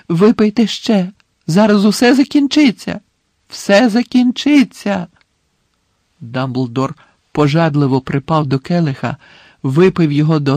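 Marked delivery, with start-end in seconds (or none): 0.1-5.1 high, loud, frantic reassurance; desperate urging. A narrator's reading. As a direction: promise relief in mounting panic, stretching the end. Panicked Harry promises it will end.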